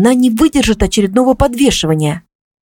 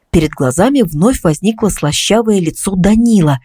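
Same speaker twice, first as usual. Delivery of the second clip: plain reading voice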